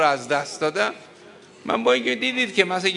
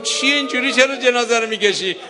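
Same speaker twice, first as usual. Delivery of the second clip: higher, louder pleading cry; anguished cry; 6 semitones higher, 4 dB louder than usual. Acted. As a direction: call out in alarm over the body. Zeinab voiced crying out.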